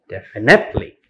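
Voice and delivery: "definitely" as defi-NIT-ely; mis-stressed "definitely", weight on NIT; wrong third-syllable stress. Shown as a demonstration. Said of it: "It's not in second syllable, like".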